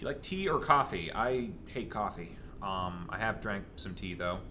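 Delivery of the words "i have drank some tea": normal talking voice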